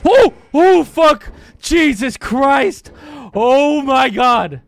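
real terrified screaming; panicked shout, high and loud; startled scream. Real, not acted. Jump scare by monster.

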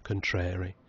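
ordinary delivery

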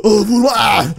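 ordinary talk

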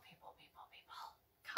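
breathy whisper calling the viewers; a soft whispered plea to the audience. Acted. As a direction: whisper their name to coax them in.

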